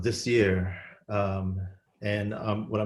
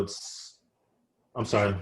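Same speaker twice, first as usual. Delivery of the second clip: normal talking voice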